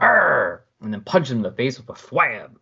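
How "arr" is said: mock pained grunt. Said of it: "He's like".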